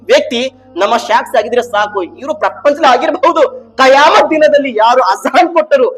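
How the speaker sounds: high, loud sermon climax; full-force preaching. Real, not acted. Preacher declaring that all creation will bear witness, full-force preaching. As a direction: proclaim it urgently to the whole hall.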